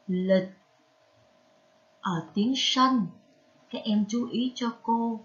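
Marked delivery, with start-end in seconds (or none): 0.1-0.5 "Lịch" said alone with the ít rhyme; careful syllable model. Shown as a demonstration. Teacher shows pupils a hard word, "Lịch" pronounced carefully with the ít rhyme. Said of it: "vần ít, đọc là lịch".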